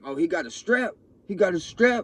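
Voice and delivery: high, loud alarmed yelling; alarmed shout. Retold or imitated. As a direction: sound the alarm that he's armed. Attackers shouting about the gun.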